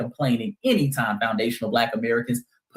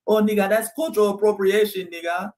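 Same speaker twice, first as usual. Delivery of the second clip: mocking complainers; 3 semitones higher than usual. Creator mocking critics of [name], indignant put-on voice. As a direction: scoff it like a huffy critic. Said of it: "They were complaining about that".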